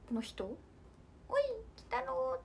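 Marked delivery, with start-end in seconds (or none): 1.3-2.4 put-on squeaky high little old man voice; Medama-oyaji impression. Idol mimics Medama-oyaji calling out to Kitaro, high squeaky voice. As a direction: call out to Kitaro like the tiny eyeball father. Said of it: "あ、これは目玉".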